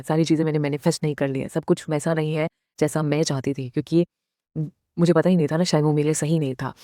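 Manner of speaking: usual speaking voice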